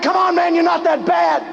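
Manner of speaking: mock your opponent with a grin; Rocky taunting Clubber Lang.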